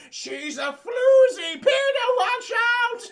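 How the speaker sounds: shrieking high falsetto, slow and loud; scandalized old lady. Retold or imitated. Old bystander shrieking at Peter about the floozy, high and loud. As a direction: yell a warning in a shrill granny squeal. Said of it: "That man's like".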